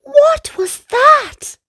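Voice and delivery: high, slow scared question; little brother spooked by a boom; startled child. Acted. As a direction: gasp it out in alarm. Kingston startled.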